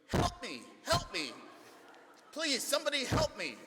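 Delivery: high, loud, desperate cries for help; a trapped man shrieking for rescue; panicked yelling for help. Retold or imitated. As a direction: yell for help as if terrified. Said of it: "he starts yelling".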